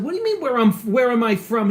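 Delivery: high, loud puzzled comeback; defensive retort. Retold or imitated. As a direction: fire the question back, bristling. His retold reply to driver.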